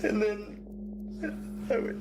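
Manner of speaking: real tearful, high-pitched voice; choked with sobs, breaking up between phrases; crying. Real, not acted. Suspect telling police he kept saying sorry, crying. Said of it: "Nah, don't cry now".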